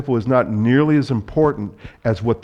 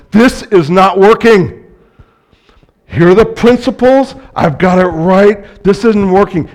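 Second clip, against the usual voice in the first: loud, high-pitched husband's rant; hammed-up marital outburst, voice raised hard; exasperated; 8 semitones higher, 11 dB louder than usual. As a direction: play a frustrated husband insisting he's right. Acting frustrated husband in marital conflict.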